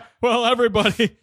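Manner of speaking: booming tipsy uncle, fast; drunk uncle voice. Pretend. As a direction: hold court at the family table. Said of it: "the drunk uncle".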